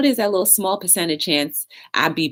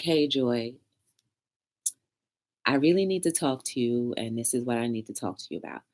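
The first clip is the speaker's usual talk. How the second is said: a touch softer, gentle and careful; soft approach; 5 semitones lower, 6 dB quieter than usual. Retold or imitated. Friend gently opening a hard talk with Joy, soft. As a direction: ease into it gently, softer as you go. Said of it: "It's soft".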